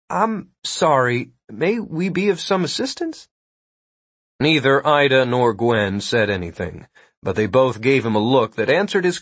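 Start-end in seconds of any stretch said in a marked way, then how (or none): 0.1-3.3 high, hesitant guard's voice; timid. A narrator's reading. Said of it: "asked in a timid voice".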